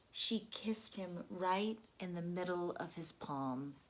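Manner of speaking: plain reading voice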